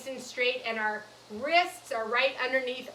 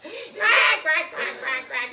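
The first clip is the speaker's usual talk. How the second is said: loud high quack tailing into quick smaller ones; duck quacking; 4 semitones higher, 6 dB louder, slower than usual. Pretend. Teacher coaxes children to quack along, duck quacking. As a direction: burst of playful duck quacks. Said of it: "Let me hear you quack".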